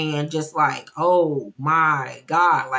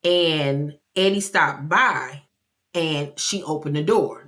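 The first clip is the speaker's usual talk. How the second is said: usual speaking voice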